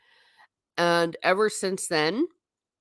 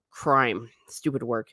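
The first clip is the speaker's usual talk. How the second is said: normal talking voice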